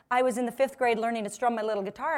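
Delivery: ordinary talk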